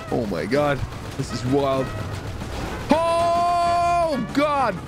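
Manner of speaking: ordinary talk, nothing marked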